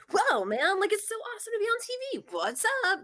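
high-pitched, excitable stoner kid impression; Spicoli-like surfer dude. Podcaster imitates the kid thrilled about TV, high excitable surfer-dude voice. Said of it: "Just, like, all energy and, like, super chill".

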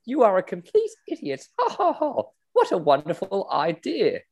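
high posh voice with a mock laugh; posh lady cackling at her lover; laughing Camilla. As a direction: hoot with delight, high and silly. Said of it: "then Camilla goes".

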